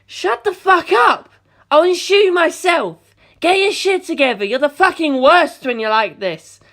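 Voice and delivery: high, loud furious yelling. Acted. Mister screaming at Doppio in rage. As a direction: tear into him.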